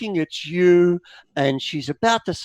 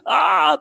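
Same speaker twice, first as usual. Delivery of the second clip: mother's anguished cry; 11 semitones higher, 4 dB louder than usual. Acted. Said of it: "she's just like".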